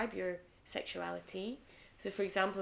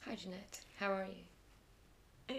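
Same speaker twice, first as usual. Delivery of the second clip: sultry greeting; 8 dB quieter than usual. Real, not acted. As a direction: purr the greeting quietly to her. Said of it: "you said that really, like, sexily".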